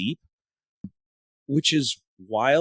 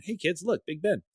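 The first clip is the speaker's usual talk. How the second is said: lightly acted, higher, softer call-out; tourist parent; 6 semitones higher, 6 dB quieter than usual. Runner mimics showing kids the sights, lightly acted parent. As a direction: point it out softly to the kids, pitch lifted. Playing tourist pointing out landmark.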